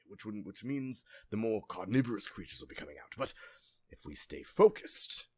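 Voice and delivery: put-on loud, urgent older man's voice; rally the group before nightfall; a lost old man urging everyone home. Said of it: "an older man first".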